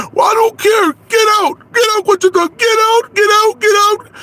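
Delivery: very high, loud repeated yell; screaming. Acted. The in-law screaming Conchita out again and again. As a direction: keep screaming get out. Shouting eviction scene.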